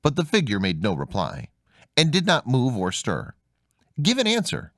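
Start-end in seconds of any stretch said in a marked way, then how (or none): 4.0-4.7 high demanding call; raised high challenge; cried out. Acted. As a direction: demand an answer from the figure. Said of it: "cried the boy".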